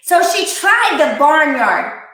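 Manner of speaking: ordinary delivery, nothing marked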